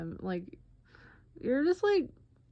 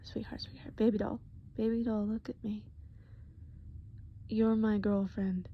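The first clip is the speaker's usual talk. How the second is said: put-on soft, hushed, caring boyfriend at the dentist; tender boyfriend Eddie; 6 dB quieter than usual. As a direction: soothe her gently, half whispering. Boyfriend soothing patient at dentist.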